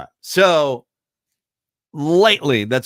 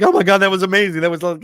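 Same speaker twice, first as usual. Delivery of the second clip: wife raving; 9 semitones higher, 6 dB louder, much faster than usual. Retold or imitated. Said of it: "She's like".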